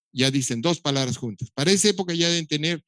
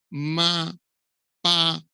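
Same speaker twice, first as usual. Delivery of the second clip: single syllable demo; 3 semitones higher, much slower than usual. Shown as a demonstration. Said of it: "es el silabeo".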